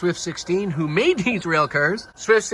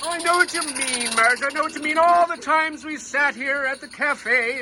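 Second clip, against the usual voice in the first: put-on higher, wistful old gentleman, slowing at the end; Henry; 9 semitones higher than usual. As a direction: play a wistful old man in a raised voice. Figure Henry answers Marge.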